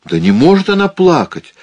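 disbelief. A narrator's reading. Father refuses to believe.

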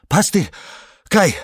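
gasping, loud call; breathless shout. Acted. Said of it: "задыхаясь, выкрикнул он".

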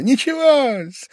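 high, slow, drawn-out reassurance; encouraging. A narrator's reading. Pavel Pavlovich mock-encouraging a flushed Velchaninov, high and slow. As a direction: pat him on the back in words. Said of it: "как бы ободряя его".

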